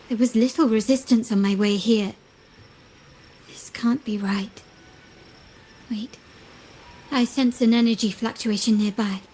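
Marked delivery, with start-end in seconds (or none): 3.5-6.2 slow, breathy whisper to herself; hushed whisper. Acted. Alone at the dock, doubting herself, slow whisper. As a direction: whisper it slowly, doubting what she sees.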